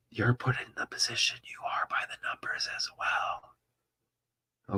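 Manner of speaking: hushed secret-telling whisper; conspiratorial whisper. Acted. Conspiratorial aside to viewers.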